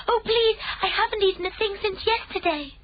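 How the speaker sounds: put-on very high, pleading child's voice; eager pleading. A narrator's reading. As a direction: beg like a starving little girl. Sophie pleading.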